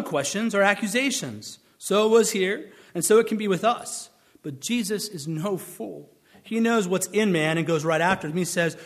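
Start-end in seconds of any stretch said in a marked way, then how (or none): none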